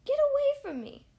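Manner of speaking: high, loud get-away yell; angry shout. A narrator's reading. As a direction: drive the little fish off with a shout. Rainbow Fish shouts him away.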